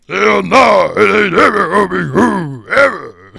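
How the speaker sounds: loud, high mock of his tough talk; hammed-up copy of a rival's threat; mocking imitation. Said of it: "echoing S1's line back".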